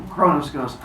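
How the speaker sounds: ordinary talk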